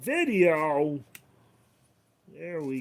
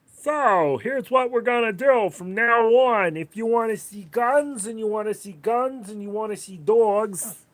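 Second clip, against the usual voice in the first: put-on thick Queens accent, higher and louder; New York accent; 5 semitones higher, 9 dB louder than usual. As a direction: ham up a Queens New Yorker announcing the schedule. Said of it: "talking like this".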